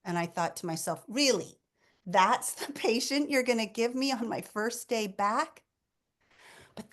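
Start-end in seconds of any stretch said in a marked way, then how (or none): 1.1-5.6 higher-pitched mock disbelief; "you can't be serious" incredulity, retold; incredulous inner protest. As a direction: protest to yourself in exasperated disbelief. Retold exasperated thought at fate.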